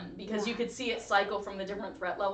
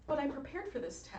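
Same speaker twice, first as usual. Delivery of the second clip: Hermione voice; 5 semitones higher, 7 dB quieter than usual. Retold or imitated. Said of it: "It's like the Hermione me".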